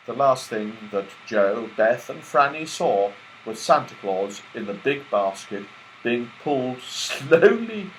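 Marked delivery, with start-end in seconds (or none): none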